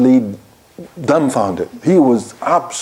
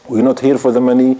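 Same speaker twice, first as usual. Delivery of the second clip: usual speaking voice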